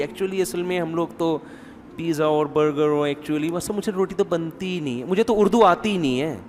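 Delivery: put-on lightly affected, showy young bride; mock airs, soft and put-on; showing off. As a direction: play the modern girl flaunting her helplessness. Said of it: "پھر اس پہ شو بھی".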